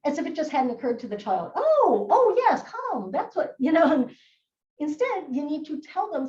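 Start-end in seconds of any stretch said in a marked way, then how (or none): 1.6-3.6 loud, high, sarcastic epiphany; hammed-up delighted "why didn't I think of that"; mock sudden realization. Upset child told to calm down, sarcastically enlightened.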